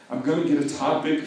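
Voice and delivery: usual speaking voice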